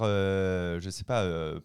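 ordinary talk

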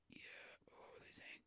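barely audible whisper; whispered to self. Real, not acted. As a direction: whisper it while searching for the lost thread. Loses thread, mutters to self.

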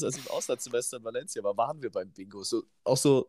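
ordinary talk

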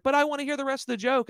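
high, fast whiny plea; whiny audience member. Retold or imitated. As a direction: beg like a disappointed fan. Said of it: "someone was like".

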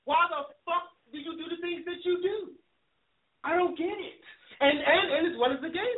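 heated rant. Real, not acted. Host venting at people he resents, heated rant. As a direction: let the outrage climb with each question.

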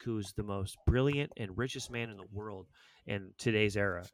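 ordinary talk, nothing marked

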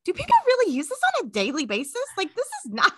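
high, fast disbelief; rattled off high in disbelief; incredulous. Retold or imitated. To coworkers, high fast incredulous mock. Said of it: "And I'm like".

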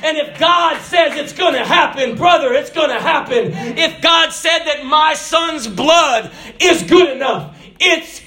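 high, driving shouted sermon; impassioned preaching. Acted. Preacher proclaims God's word to the congregation, impassioned shouted preaching. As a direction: preach at full cry, stretching the key words.

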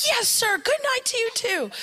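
very high, loud yell back; shrieked mock-obedient answer; shouted reply. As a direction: shout it back like cadets to a sergeant. Girls shout back at yelling Paul.

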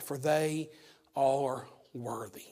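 whispered close of the verse; hushed scripture. Acted. Preacher reading scripture to his church, hushed whisper. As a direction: let the last words fall to a whisper. Scripture read, voice drops to hush.

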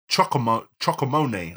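ordinary delivery

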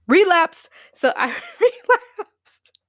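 high, loud laughing confession. Real, not acted.

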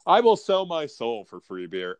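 ordinary talk

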